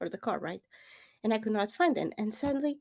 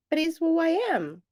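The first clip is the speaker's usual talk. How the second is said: higher, louder self-defence; mild protest; 9 semitones higher, 6 dB louder than usual. Retold or imitated. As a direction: push back with a raised voice. Protesting to critics, retold.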